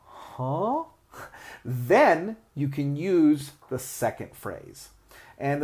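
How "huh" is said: puzzled huh from a listener; confused listener. Retold or imitated. Listener confused by a wrong answer, puzzled huh. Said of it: "look at you with a strange face".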